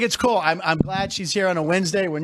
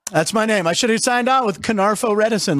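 normal talking voice

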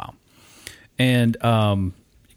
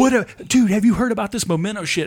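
high, eager "dude, have you heard"; excited friend; 7 semitones higher than usual. Retold or imitated. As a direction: pitch it up like a hyped friend. Friend excitedly asks about Memento.

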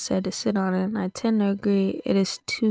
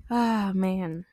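normal talking voice

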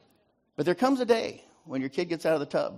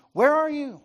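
God calling out; 12 semitones higher, 6 dB louder, slower than usual. Acted.

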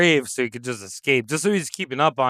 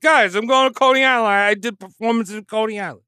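put-on Brooklyn accent; 5 semitones higher, faster than usual. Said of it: "he's from Brooklyn".

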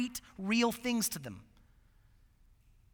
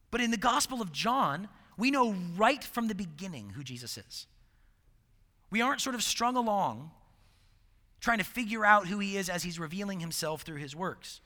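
ordinary delivery

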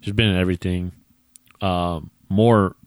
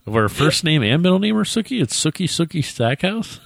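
baited disbelief; 10 semitones higher than usual. Real, not acted. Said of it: "you sound like you're getting fucking baited".

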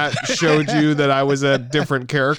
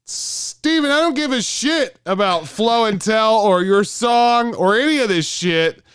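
yelling radio host; 5 semitones higher than usual. Pretend. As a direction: rant at the caller. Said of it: "all I did was start yelling".

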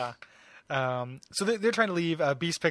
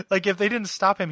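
ordinary delivery

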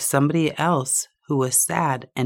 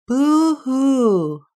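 high loud wail from afar; echoed boo-hoo; 10 semitones higher, 6 dB louder than usual. A narrator's reading. Unseen duck wailing back across the water. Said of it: "and then he heard".

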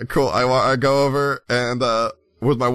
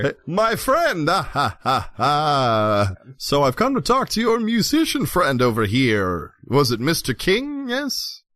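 Laszlo, jovial stranger; 3 semitones higher than usual. Acted. Laszlo hailing his friend in the bar, theatrically jovial.

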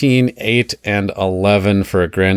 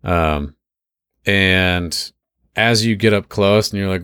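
ordinary delivery

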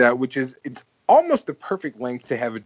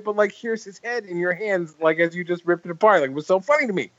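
normal talking voice

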